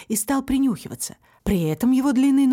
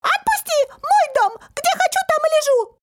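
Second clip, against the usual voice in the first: very high, piercing protest; squeaky shouting; 4 dB louder than usual. A narrator's reading. Little man dragged by the legs, shrieking protest. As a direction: yell indignantly as a tiny creature. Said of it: "продолжал кричать".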